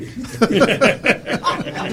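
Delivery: hearty laugh. Real, not acted. Laughs at own joke punchline.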